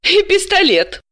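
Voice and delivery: high, businesslike with a mocking edge on «И пистолет»; dry irony. A narrator's reading. Dalila teasing the visitor about the pistol, dry and ironic. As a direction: needle him about the missing gun. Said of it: "с деловитой иронией осведомилась".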